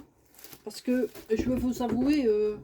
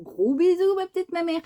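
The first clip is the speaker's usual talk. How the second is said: high squeaky pet voice sending kisses; high cutesy voice of a little bird; voicing the bird; 7 semitones higher than usual. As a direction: speak for the bird in a tiny voice.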